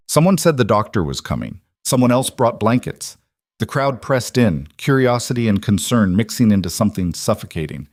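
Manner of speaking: ordinary delivery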